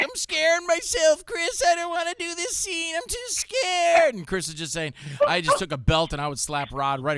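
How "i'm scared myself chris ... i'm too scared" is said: much higher, slow, frightened squeal; mock scared whimper. Rod begging Chris to skip the scary scene, mock terrified. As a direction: whine like a terrified wimp. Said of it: "he was like, why".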